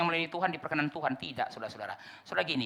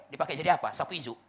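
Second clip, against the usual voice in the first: ordinary delivery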